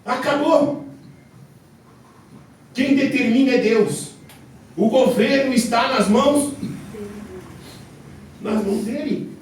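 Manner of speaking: loud, high shouted sermon; fiery preaching. Acted. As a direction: thunder it from the pulpit. Preaching at full force.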